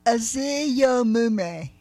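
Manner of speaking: very slow Aziyomume, syllable by syllable; slow practice. Shown as a demonstration. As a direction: sound out Aziyomume carefully. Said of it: "let's try this slowly".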